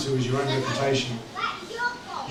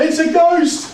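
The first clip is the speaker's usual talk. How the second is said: high, loud cry of alarm, acted; hammed-up scream of fright from the page; frightened scream; 12 semitones higher, 8 dB louder, much slower than usual. A narrator's reading. Disciples spot a figure on the sea, acted frightened scream. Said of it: "they screamed out with fright".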